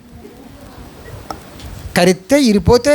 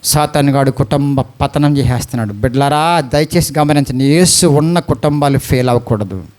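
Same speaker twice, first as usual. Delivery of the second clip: grave low appeal; 8 semitones lower than usual. Real, not acted. Preacher's grave warning.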